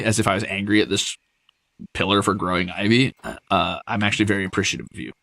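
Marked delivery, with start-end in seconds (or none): none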